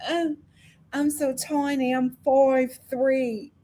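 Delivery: slow, drawn-out mock-coy simper; performing tininess. Host imitating Francesca flirting over height, mock-coy. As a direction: play small and helpless, stretching the words. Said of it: "she's like".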